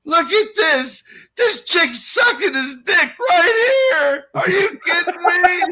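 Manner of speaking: high, loud and slow, half crying in disbelief; lawn guy yelling. Retold or imitated. Storyteller imitates the lawn guy gawking at a couple, high crying yell. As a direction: mimic a drunk guy howling at the scene. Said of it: "I hear the lawn guy going".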